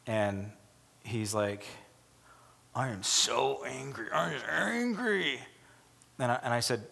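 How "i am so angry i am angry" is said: slow, heavy mock anger; a pastor acting out a man stewing in anger; imitating angry man. Slow, heavy mock anger.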